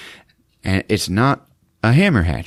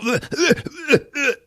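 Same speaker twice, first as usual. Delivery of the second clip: high strangled choking noises; mock choking grunts. Jim being choked, mock choking grunts. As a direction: gag as if choked. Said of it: "he's like".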